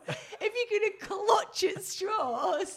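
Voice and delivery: loud, high, laughing protest; real laughter breaking into a shouted tease; laughing telling-off. Real, not acted. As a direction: scold him loudly through helpless laughter. Teasing partner who forgot the conversation.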